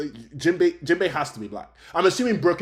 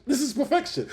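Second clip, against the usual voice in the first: This pitched much higher, awed; higher amazed repeat; 12 semitones higher than usual. Retold or imitated. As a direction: say it again, soaring with delight. Retold reaction to Kuro scene.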